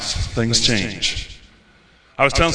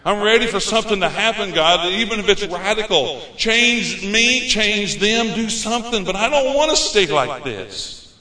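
higher-pitched, urgent plea; desperate plea; 7 semitones higher than usual. Retold or imitated. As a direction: retell a desperate plea to God. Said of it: "And so I got desperate".

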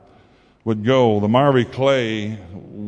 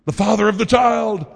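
mock frantic reassurance; 10 semitones higher than usual. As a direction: reassure her in a high panicked voice. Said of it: "I said".

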